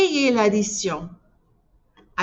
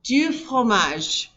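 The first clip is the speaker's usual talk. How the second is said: slow model for repeating; much slower than usual. Shown as a demonstration. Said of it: "Say it after me".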